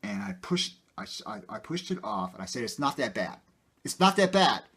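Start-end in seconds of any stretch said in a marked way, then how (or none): 3.8-4.6 loud, high retold outburst; angry insistence in a loud, high voice; blew up. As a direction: snap it back at him as you blow up. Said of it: "I just, I just blew up".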